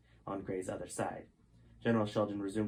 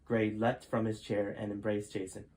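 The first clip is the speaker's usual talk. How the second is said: usual speaking voice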